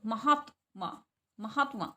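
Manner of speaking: महात्मा as "ma-haat-maa"; half-ta pronunciation. Shown as a demonstration. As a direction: stop briefly on the half t in महात्मा. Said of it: "आधे तय की". (महात्मा में आधे त का उच्चारण)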